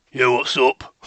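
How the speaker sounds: a laid-back dude deer; casual deer voice. Pretend. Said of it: "The complete opposite to what".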